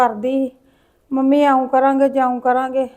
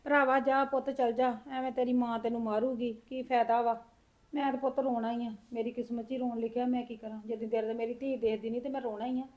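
much softer, tearful resignation; tearful grandmother; 13 dB quieter than usual. Acted. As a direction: weep quietly as you send the boy home. Grandmother weeping over lost daughter. (ਰੋਂਦੀ ਹੋਈ ਹੌਲੀ ਦਾਦੀ)

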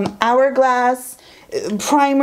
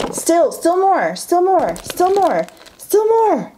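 chanting higher each time up to a squeal; building excitement; 8 semitones higher than usual. Real, not acted. Pulling endless items for viewers, building high chant. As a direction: climb the pitch with every "still more".

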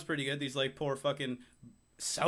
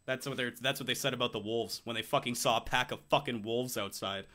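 normal talking voice

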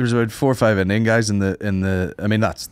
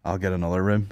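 offhand suggestion; 4 semitones lower, 8 dB quieter than usual. Retold or imitated. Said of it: "so I was like".

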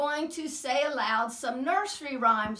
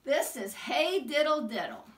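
ordinary delivery

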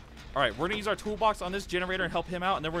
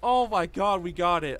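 1 giddy relief; 2 semitones higher, 5 dB louder, slower than usual. Real, not acted. Celebrating the challenge.

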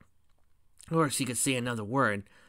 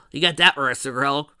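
amused, louder, with a little laugh; chuckling; 3 semitones higher, 7 dB louder than usual. Acted. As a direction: chuckle your way into the line. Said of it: "pony chuckled".

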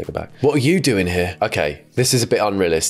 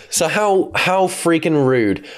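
mock offended; 8 semitones higher, slower than usual.

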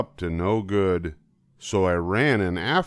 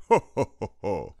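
cat's hearty laugh; faster than usual. A narrator's reading. The Cat in the Hat laughing at the children, hammed-up ho ho. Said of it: "laughed the cat".

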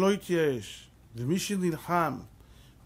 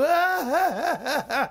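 much higher, louder scornful laughter; imitating a tractor driver's mocking laugh; 11 semitones higher, 4 dB louder, much slower than usual. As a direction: laugh in his face. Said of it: "שבתאי, מה אתה צוחק?".

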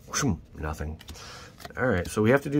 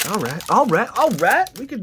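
rising higher and louder with each repeat; building hype; 13 semitones higher, 8 dB louder than usual. Real, not acted. Hyping viewers before the next pull, building excitement. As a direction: crank up the excitement each time.

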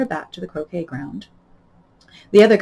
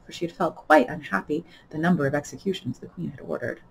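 ordinary delivery, nothing marked

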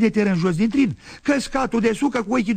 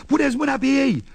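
retold crowd yell; 6 semitones higher than usual. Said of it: "urla lumea".

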